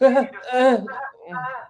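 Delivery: high laugh. Real, not acted. Laughing at own joke.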